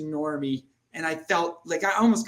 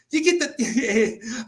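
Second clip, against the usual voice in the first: laughing; 6 semitones higher, much slower than usual. Real, not acted. Laughing at his own outburst.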